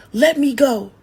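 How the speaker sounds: loud, high yell. Acted. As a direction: yank away and yell it. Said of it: "she yelled".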